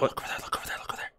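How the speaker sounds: hushed unvoiced whisper. Real, not acted. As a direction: whisper so she won't hear. Noticing someone, urging secrecy.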